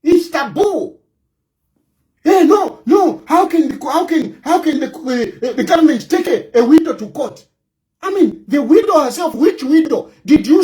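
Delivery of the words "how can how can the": usual speaking voice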